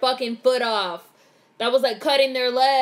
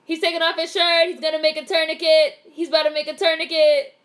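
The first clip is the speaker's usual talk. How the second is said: higher, louder, eager play-by-play; excited live reaction; 6 semitones higher, 3 dB louder than usual. Real, not acted. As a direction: call out each move as tension builds. Reacting live to Saw scene.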